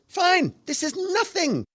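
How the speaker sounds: high, slow, breezy dismissal; unfazed producer. Retold or imitated. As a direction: wave it all away like nothing matters. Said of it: "And he goes".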